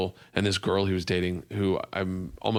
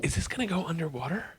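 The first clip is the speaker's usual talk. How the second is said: soft, higher-pitched imitation of a girlfriend; imitated confiding question; 6 semitones higher, 7 dB quieter than usual. Comic imitates girlfriend leaning in to her boyfriend, soft higher voice. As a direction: lean in and ask it softly, pitched up. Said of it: "I hear her lean into Russell".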